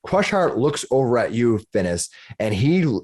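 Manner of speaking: normal talking voice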